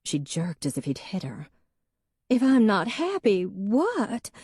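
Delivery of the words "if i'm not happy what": much higher, louder, startled and hurt; higher, frightened challenge; shaking voice. A narrator's reading. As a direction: snap back in sudden alarm. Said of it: "her voice was shaking".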